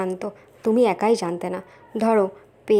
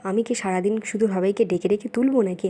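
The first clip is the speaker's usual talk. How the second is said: usual speaking voice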